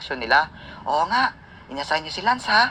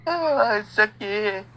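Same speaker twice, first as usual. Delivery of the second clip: pained moan; 5 semitones higher, much slower than usual. Acted.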